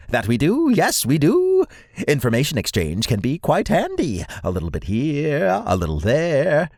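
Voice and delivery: chattering squirrel creature, very high; high-pitched voice. Acted. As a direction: squeak along, sending phrase endings soaring. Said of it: "in a high-pitched voice".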